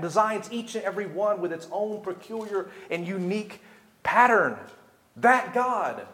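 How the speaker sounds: high, loud sermon voice building in force; impassioned preaching. Real, not acted. Preacher telling the congregation whom Hannah addressed, impassioned preaching. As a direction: proclaim God's greatness, punching the big titles.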